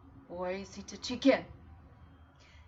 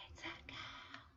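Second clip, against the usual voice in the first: whispered, far softer; 19 dB quieter than usual. Shown as a demonstration. Teacher whispering the sentence back to kids. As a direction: whisper the echo softly.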